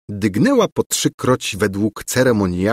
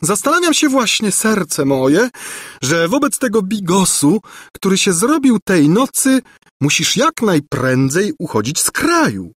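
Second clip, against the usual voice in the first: high, animated character voice; scheming governess, urgent; 11 semitones higher than usual. A narrator's reading. Governess urging the prince to flee, high and pressing. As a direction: urge him on in a high-pitched voice.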